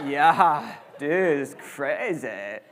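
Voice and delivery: slow, spaced-out surfer-dude drawl; California stoner voice. Retold or imitated. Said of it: "my California, like, stoner voice".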